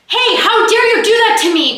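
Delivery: high, loud mock angry outburst; mock outrage. Shown as a demonstration. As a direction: hit it like an acted scene. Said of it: "your voice is prepped from hitting".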